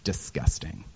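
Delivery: low, hushed and sneering; catty gossip. Retold or imitated. Imitating a Midwestern neighbour gossiping about a guest, hushed and catty. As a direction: dish the dirt under your breath. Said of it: "the most is like".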